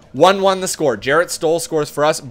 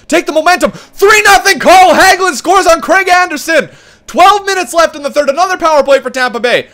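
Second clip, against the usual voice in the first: higher, louder hyped commentary; excited play-by-play; 5 semitones higher, 3 dB louder than usual. Real, not acted. Gamer hyped while his team pulls ahead in game six. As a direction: call the goals with rising joy.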